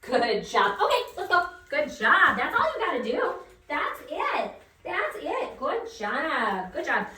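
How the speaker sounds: loud, high praise for the dog; excited praise. Real, not acted. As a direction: reward him with a burst of praise. Praising dog after success.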